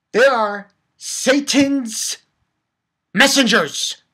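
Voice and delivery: slow, loud, punched declaration; emphatic declaration. Real, not acted. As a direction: land each word like a verdict. Climax of rant.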